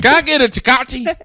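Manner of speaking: hammed-up Southern accent. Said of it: "say everything else in a Southern accent".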